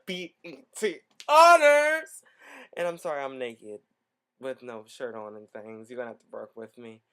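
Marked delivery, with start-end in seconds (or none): 0.1-2.2 loud, high announcer shout; excited shout. Acted. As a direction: belt it like a hype host. Announcing the awards show.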